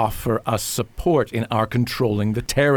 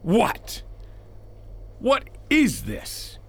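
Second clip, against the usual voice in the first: shocked; 12 semitones higher, 4 dB quieter, slower than usual. Acted. Senator shocked by planted money.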